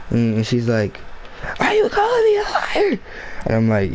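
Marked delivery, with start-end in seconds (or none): 1.6-3.0 very high mock scream; screaming teacher. Teacher challenging student over being called liar, mock screaming. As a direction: screech it in an imitated teacher's falsetto. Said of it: "she started screaming at the top of her lungs".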